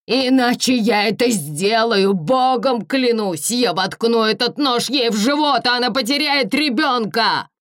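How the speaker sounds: high, loud frantic threat; threatening shout. Acted. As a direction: yell the threat, cornered and wild. Hostage threat with knife.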